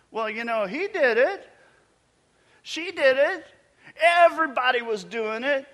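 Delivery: high, loud whining excuse-maker; mock excuses. Preacher mocking the crowd's excuses, high and loud. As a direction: pass the blame like a caught kid. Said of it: "this isn't gonna work".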